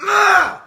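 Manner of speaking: loud, high exasperated "ugh"; frustrated groan. Real, not acted. Fed up with fans, loud frustrated groan. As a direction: let the frustration burst out. Frustrated outburst mid-rant.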